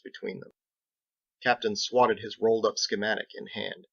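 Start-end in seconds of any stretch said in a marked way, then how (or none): none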